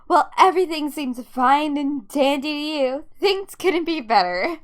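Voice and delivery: loud, biting mock cheer; angry sarcasm. Daughter accusing dad of not caring, angry sarcasm. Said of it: "made me feel so angry".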